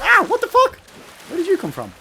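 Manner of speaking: high, loud startled outburst; startled yelp. Real, not acted. Gamer ambushed mid-stream, yelping in startled shock. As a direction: jump at a sudden attack and yell.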